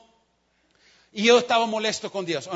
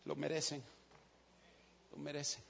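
quiet sincere repeat; 7 semitones lower, 18 dB quieter than usual. Real, not acted. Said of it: "den una palmadita".

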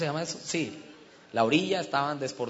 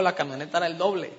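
ordinary talk

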